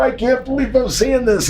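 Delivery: high-pitched scandalized disbelief; mimicked shock. Maid to the stranded wrestler, shocked. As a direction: imitate a stunned woman repeating herself.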